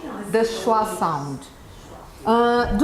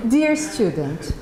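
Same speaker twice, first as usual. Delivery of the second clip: ordinary delivery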